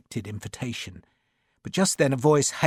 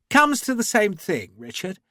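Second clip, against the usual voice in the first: higher, breezy gentleman's shrug; cheerful; 8 semitones higher, 4 dB louder than usual. A narrator's reading. Lavisser answering Sharp's accusation, cheerful. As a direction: toss it off lightly. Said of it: "Lavisser said cheerfully".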